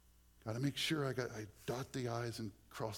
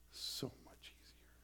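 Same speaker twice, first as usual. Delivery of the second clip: breathy whispered relief; 9 dB quieter than usual. Real, not acted. Preacher marveling at grace to the congregation, whispered. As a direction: let it out in a whisper.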